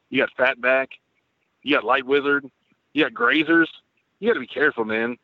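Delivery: ordinary talk, nothing marked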